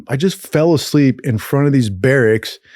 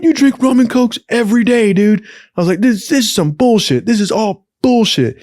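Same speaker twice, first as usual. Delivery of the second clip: angry outburst; 10 semitones higher than usual. Retold or imitated. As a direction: snap back at the boss in outrage.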